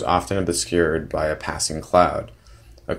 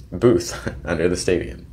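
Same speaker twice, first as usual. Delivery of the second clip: small laugh after the first word; reading on through a brief laugh; amused self-correction. Real, not acted. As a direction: correct yourself and chuckle mid-phrase. Laughs at own misreading while correcting.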